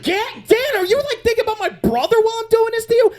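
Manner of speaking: put-on high, fast accusing girl voice; a girl catching him out, voiced high; mock suspicious girlfriend. Girl confronts him in bed.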